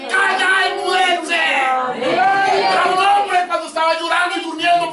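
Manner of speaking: high, loud sustained pulpit shout; roaring revival-preacher crescendo; preaching at full force. Real, not acted. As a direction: thunder it out, stretching the climactic commands. Climax of the sermon.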